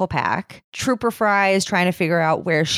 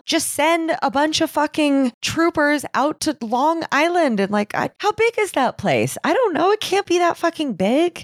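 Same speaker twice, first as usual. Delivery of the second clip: very high-pitched, incredulous venting; exasperated rant; 11 semitones higher than usual. Real, not acted. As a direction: demand action in real frustration. Host vents at the dispatcher.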